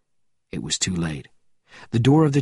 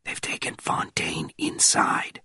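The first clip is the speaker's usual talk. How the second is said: hushed whisper, barely voiced; 6 semitones lower, 6 dB quieter than usual. A narrator's reading. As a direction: whisper it close to his ear.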